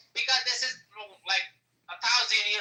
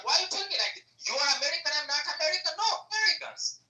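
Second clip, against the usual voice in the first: much higher, incredulous questioning; indignant protest; 7 semitones higher, faster than usual. Real, not acted. [name] insisting he is American, indignant. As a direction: protest in a high, offended voice.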